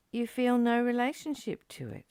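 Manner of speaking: ordinary delivery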